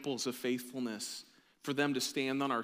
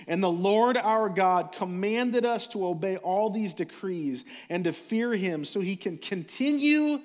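loud, slow, ringing proclamation; full-force preaching; 4 semitones higher, 7 dB louder than usual. Acted. Pastor rewording scripture for his church, preaching full force. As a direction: proclaim deliverance from sin with power.

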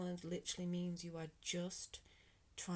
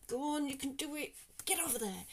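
higher, louder pushy cheer; mock push; 8 semitones higher, 4 dB louder than usual. Vlogger imitating a pushy voice driving her on, loud. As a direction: shove someone along like a pushy coach. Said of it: "not like".

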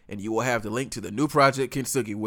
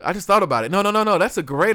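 excited exclamation; 8 semitones higher, 7 dB louder, faster than usual. Real, not acted. Excited about breaking the NBA.

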